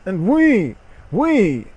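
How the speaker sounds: hammed-up dramatic announcement of the erotic novel; mock grand announcement. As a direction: present it like a ringmaster, tongue in cheek.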